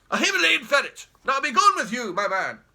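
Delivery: loud, barking orders to a servant; bossy command. Acted. Jeremy ordering the slouching taxi driver about, loud and bossy.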